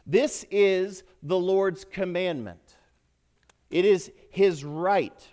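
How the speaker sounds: slow, loud and forceful; emphatic preaching. Acted. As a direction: drive home each word from the pulpit.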